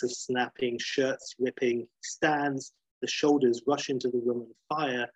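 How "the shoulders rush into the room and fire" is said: usual speaking voice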